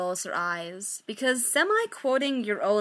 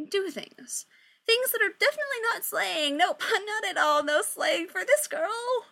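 higher, awkward with nervous laughs; awkward nervous Victoria; 5 semitones higher than usual. Acted. Victoria awkwardly lying to her band about a break. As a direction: fake casual, giggling through the lie. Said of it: "She kind of laughs awkwardly".

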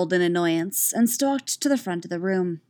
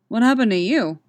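louder, concerned question; worried; 4 semitones higher, 7 dB louder than usual. A narrator's reading. Elliot seeing the bruise on Katara, worried. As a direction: ask with startled concern.

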